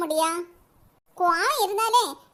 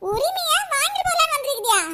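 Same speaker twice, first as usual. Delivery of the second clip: mocking laugh; 6 semitones higher than usual. Mocking the daughter's demand.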